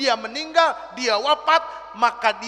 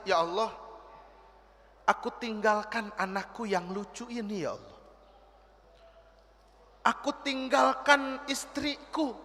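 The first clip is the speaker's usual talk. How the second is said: a touch of tearful, much softer pleading; softly weeping servant; 2 semitones lower, 10 dB quieter than usual. Acted. Dying servant praying softly to God, tearful. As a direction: plead quietly, near tears. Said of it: "si hamba sedikit menangis".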